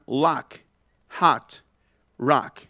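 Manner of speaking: "lock, hot, rock" with a wide open A coloured by O; open vowel. Shown as a demonstration. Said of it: "abriendo más la boca".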